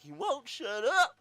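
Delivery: drawn-out, high mock complaint; loud comic retelling of a griping aside; Bill Burr. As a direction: squawk it loud and slow, imitating exasperation. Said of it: "he makes this face like".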